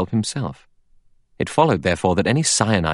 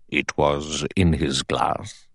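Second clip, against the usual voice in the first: put-on lower, slow, measured old judge; sharp question; 4 semitones lower, much slower than usual. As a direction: press the point like a judge. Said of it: "The judge said sharply".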